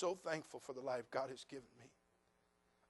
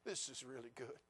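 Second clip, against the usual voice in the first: sarcastic; 3 semitones lower, 11 dB quieter than usual. Retold or imitated. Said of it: "You think I saw all that and said".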